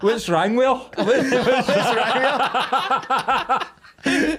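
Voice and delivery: high, laughing, last words stretched out; laughing disbelief.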